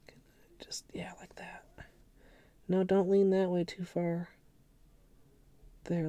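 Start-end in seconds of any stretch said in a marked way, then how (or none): 0.1-1.6 hushed unvoiced whisper. Real, not acted. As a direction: whisper while concentrating. Whispering while placing sticker.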